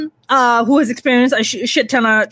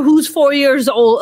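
ordinary talk, nothing marked

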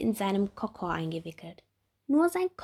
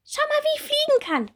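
very high, loud happy call; delighted little bee; 7 dB louder, faster than usual. Acted. Bumblebee proudly showing Ricardo her flight, very high and happy. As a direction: show off proudly. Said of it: "freut sich die kleine Hummel".

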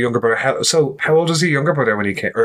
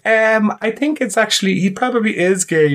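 higher-pitched, put-on awkward answer; under pressure; 8 semitones higher than usual. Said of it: "pressure to just be like".

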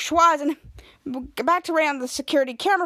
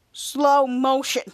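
slow drawn-out words; much slower than usual. Retold or imitated. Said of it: "and Henry said".

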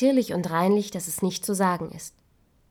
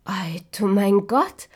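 louder, slowed exclamation of wonder; loud astonished cry; 4 dB louder, slower than usual. Acted. Dwarves marvelling at the girl, loud slow cry. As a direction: cry it out slowly in amazement. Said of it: "riefen sie".

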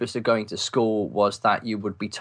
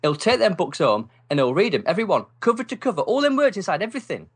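high, rising mock amazement; scornful mocking kid; 7 semitones higher than usual. Friend mocking the bookish boy to his mum, high scornful wonder. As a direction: ridicule the bookworm to his mum.

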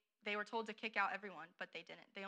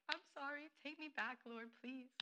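higher, mock-pleading plea; 5 semitones higher, 6 dB quieter than usual. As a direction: beg the Lord, a little hammed-up. People crying out to the Lord.

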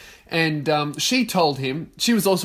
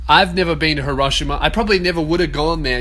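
normal talking voice

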